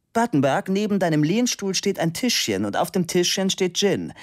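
high-pitched directing voice; calling across the studio. A narrator's reading. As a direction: call over to a friend for a favour. Calls across studio for gin.

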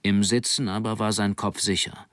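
ordinary delivery, nothing marked

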